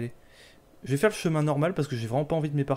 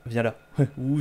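ordinary talk

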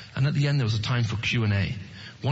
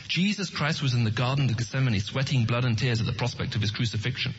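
normal talking voice

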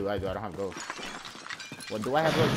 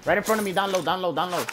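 urgent callout; 6 semitones higher, 4 dB louder than usual. Real, not acted. Enemy spotted close by.

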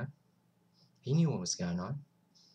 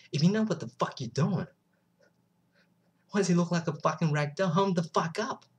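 higher, heated telling-off; raised voice, indignant challenge; angry confrontation; 6 semitones higher, 4 dB louder than usual. Retold or imitated. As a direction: relive the outburst at the nurses. Retelling his outburst at nurses.

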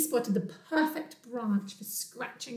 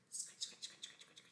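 rapid breathy sound-effect words; whispered scratching; 24 dB quieter than usual. Acted. Bear scratches on tree trunk, whispered repetition. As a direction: whisper it like rasping claws. Voices Bear's scratching in story.

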